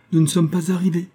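mocking, high-pitched jibe; Calinette. A narrator's reading. Girl mocking James's escape plan, high and teasing. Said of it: "lança Calinette moqueuse".

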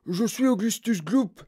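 high-pitched put-on little boy voice; high child character voice; Augustus Gloop. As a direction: pipe up as a boy introducing himself. Child introduces himself to host.

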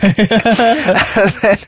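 normal talking voice

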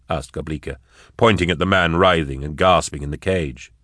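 ordinary delivery, nothing marked

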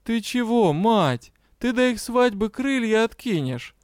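high-pitched, plaintive question; sadly asking. A narrator's reading. Dragon doubting the kitten will last, plaintive. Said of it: "печально спросил".

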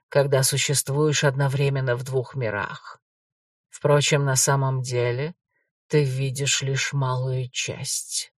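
low, slow, eerie ghost; a calm brother from beyond the grave; shadow ghost voice. Acted.

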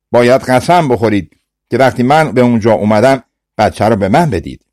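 plain reading voice

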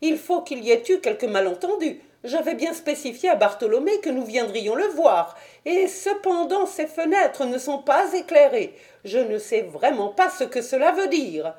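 high-pitched, puzzled gentleman; high, troubled little man; Thaddeus, uneasy. Acted. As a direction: worry aloud in a high voice. Voices Thaddeus, ill at ease.

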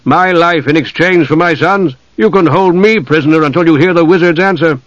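impassioned demand. A narrator's reading. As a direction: insist desperately on the exchange. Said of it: "Caramon demanded".

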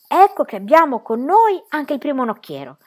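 high, loud, pleased announcement; delighted. A narrator's reading. Pippi greets the horse as first helmsman, delighted. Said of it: "disse Pippi tutta contenta".

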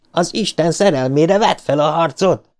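high, pleading rallying cry; urgent shout. A narrator's reading. Richie begs Bill to fight back, high urgent cry. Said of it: "kiáltotta Richie".

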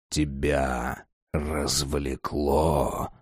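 deep, slow menacing growl; low drawn-out monster voice; menacing vampire. Acted. Vampire speaks from darkness.

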